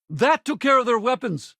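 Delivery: high-pitched urgent battle report; combat callout. Acted. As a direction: call it out mid-fight, pitched high. Combat status call.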